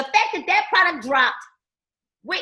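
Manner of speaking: ordinary talk, nothing marked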